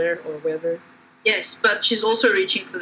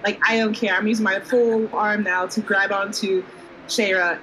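ordinary delivery

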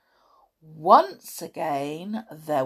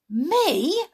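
high startled protest; can't believe it's him; 11 semitones higher than usual. A narrator's reading. Tom protesting when Amber picks him, high surprise. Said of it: "protested the boy".

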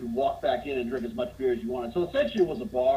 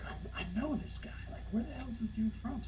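hushed, half-whispered wondering to yourself; puzzled muttering; 7 semitones higher, 12 dB quieter than usual. Retold or imitated. Man eyeing a familiar drunk across the bar, hushed puzzlement. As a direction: drop the volume as you try to place a face.

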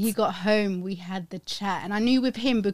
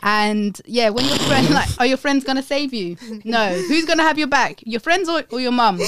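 loud lecture; 3 semitones higher, 8 dB louder than usual. Retold or imitated. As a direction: drive the point home loudly. Lecturing son retold.

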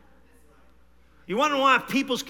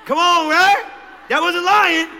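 loud laughing outburst, higher; 8 semitones higher, 8 dB louder, slower than usual. Real, not acted. Pastor laughing with the congregation at his own story, loud and high.

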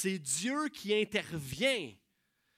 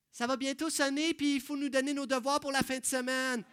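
higher, lightly voiced cheeky kid; eager pupil; 7 semitones higher, 5 dB louder than usual. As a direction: remind the teacher about homework. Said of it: "Tu sais, cet élève-là".

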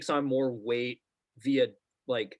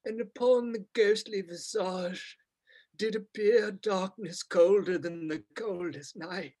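overwrought theatrical reading, higher; British thespian voice; 6 semitones higher than usual. Retold or imitated. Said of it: "weird British thespian voice".

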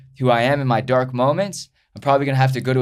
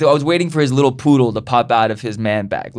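ordinary talk

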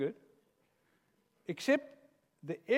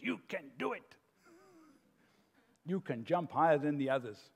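evil advisor voice; 2 semitones lower than usual. Acted. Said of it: "the evil advisor says".

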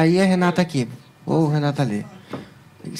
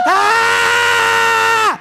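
high loud joyful scream; celebration scream; 5 dB louder than usual. Real, not acted. Streamer screams to celebrate a new member, high loud joyful scream. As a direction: let out a real victory yell. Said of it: "Grita, quando consegue membro".

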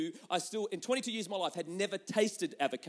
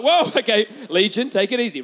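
loud, jokey backing off; mock alarmed shout; 13 dB louder than usual. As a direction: shout it like you're fending off a possessed wife. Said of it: "I'm like".

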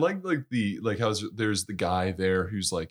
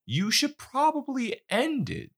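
high, slow, careful suggestion; friendly. Retold or imitated. Dead friend gently advising his buddy, friendly. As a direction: imitate a pal easing into awkward advice. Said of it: "speaking to him like a friend".

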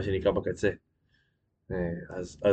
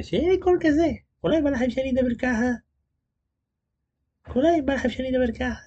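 much higher mock squeak; squeaky put-on voice. Shown as a demonstration. Streamer mocking viewers who comment on his voice, in a high squeaky put-on voice. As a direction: tease the chat with a silly squeaky voice. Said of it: "למה כולכם מתעסקים בקול שלי".

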